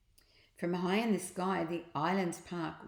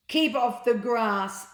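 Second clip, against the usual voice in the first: louder, higher, slow bossy command; stern sign; 4 semitones higher, 8 dB louder, slower than usual. Acted. Park keeper's sign ordering people off the grass, loud and stern. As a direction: bark it like a strict warning sign.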